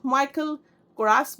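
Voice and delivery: माइकल कोरास as MY-kul ko-RAAS; long aa ending कोरास, after a drawn-out माइकल; variant brand pronunciation. Shown as a demonstration. Vlogger listing how others say the brand, variant pronunciation. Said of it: "बोलते हैं".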